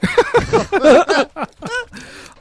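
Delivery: loud, high burst of laughter; big laugh. Real, not acted. Host cracking up at the whispered tease, loud laughter. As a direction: crack up loudly, pitch shooting up.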